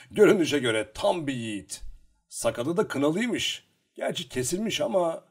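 mock the dead man with light scorn. A narrator's reading. Lukaşka talking about the dead scout, mocking. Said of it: "alaylı bir sesle konuşuyordu".

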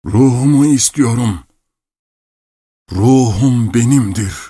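put-on loud, high old man's insistence; fierce demand. A narrator's reading. Obsessed clockmaker claims back his soul, loudly insistent. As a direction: proclaim it forcefully, drawing out the words.

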